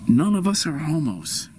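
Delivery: usual speaking voice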